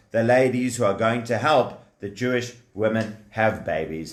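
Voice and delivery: ordinary delivery, nothing marked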